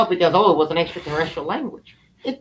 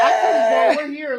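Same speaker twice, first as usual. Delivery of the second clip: shouting; 7 semitones higher than usual. Real, not acted. Shouted lead into new topic.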